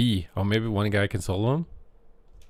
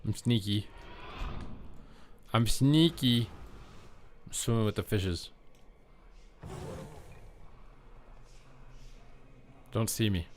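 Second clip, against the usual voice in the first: playful sneaky voice; 8 dB quieter than usual. Pretend. Sneaking player to himself in the corner, playfully hushed.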